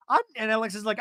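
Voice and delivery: ordinary talk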